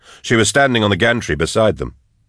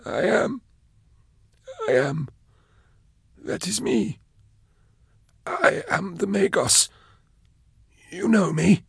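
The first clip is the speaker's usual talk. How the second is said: much higher, slow, halting and stammered; a man who has forgotten how to speak; broken prisoner voice; 13 semitones higher, 5 dB quieter than usual. Acted. Caged prisoner pleading to be recognised, high halting voice.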